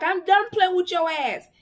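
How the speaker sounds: put-on retelling the boyfriend losing patience; fed-up boyfriend. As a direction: snap at her like you've had enough. Boyfriend fed up, drags her home.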